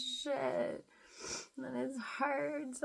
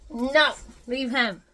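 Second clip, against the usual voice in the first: sharp command; 13 dB louder than usual. Real, not acted. Stopping the dog.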